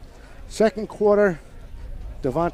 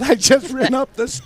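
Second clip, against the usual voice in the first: gasping mid-sentence; breathless from the stairs; out of breath; 8 semitones higher, 8 dB louder than usual. Real, not acted. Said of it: "I just ran up the stairs".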